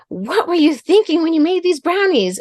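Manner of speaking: put-on high accusing kid voice; lightly voiced accusing kids. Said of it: "And they said".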